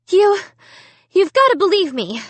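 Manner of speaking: high, loud stammered plea; sputtering. A narrator's reading. Amy sputters, begging her sister Sarah to believe her. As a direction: stumble over the first word in a rush. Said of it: "I sputtered".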